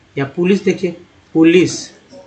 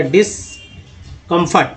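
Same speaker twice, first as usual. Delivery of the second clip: Discomfort slowly, pausing after डिस; split prefix; much slower than usual. Shown as a demonstration. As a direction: set डिस apart, then finish discomfort. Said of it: "डिस को लाया गया". (Discomfort में डिस के बाद ठहरकर)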